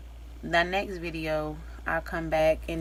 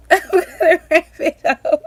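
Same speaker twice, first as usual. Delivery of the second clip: much higher and louder, laughing the words; 12 semitones higher, 16 dB louder than usual. Real, not acted. Emotional mom laughing while repeating that she'll wrap up. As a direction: burst into a laugh as you repeat it. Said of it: "I am getting emotional".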